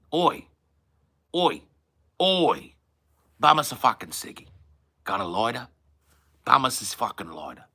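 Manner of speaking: broad Aussie, jumping high on the first words; Australian accent. Shown as a demonstration. Getting into the accent by saying oi first, Australian accent. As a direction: cadge a smoke in broad Australian. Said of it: "I have to do a certain kind of Australian".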